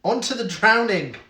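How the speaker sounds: loud game show announcement; announcing loudly. Acted. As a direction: call it out like a quiz host. Quiz host announcing next round.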